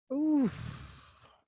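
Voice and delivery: soft, breathy "ouf" of relief; puffed sigh. Real, not acted. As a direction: let out a long, soft puff of air. Pauses talk to drink water.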